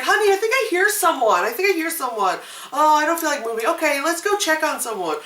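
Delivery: high put-on voice of a complaining spouse; oblivious spouse. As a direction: mimic a reluctant partner in bed. Voices oblivious couple at night.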